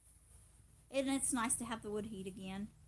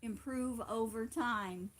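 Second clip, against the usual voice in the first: "Improve over time" said slowly, every word separated; slow and clear; slower than usual. Shown as a demonstration. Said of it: "Who's on overtime?".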